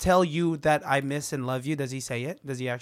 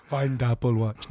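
slow, blunt caveman grunt; 5 semitones lower, slower than usual. Retold or imitated. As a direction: talk like a dim caveman. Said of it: "Why did you say it like a caveman?".

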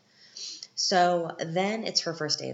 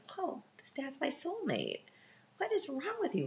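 much higher, giddy exclamation; mock thrilled; 13 semitones higher than usual. Viewer cheers on the dad letting her stay home, giddy. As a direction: gush like you've found your soulmate. I'm like.

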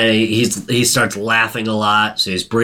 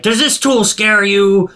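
higher, louder mock-menacing dentist; a hint of a sadistic dentist; 9 semitones higher, 3 dB louder than usual. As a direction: lean in and taunt with the tool. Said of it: "and he's like".

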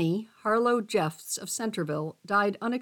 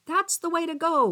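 lifted, higher-pitched cheer; cheering encouragement; 7 semitones higher than usual. A narrator's reading. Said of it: "Larry said".

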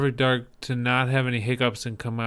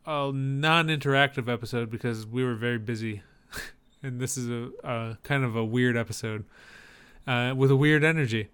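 usual speaking voice

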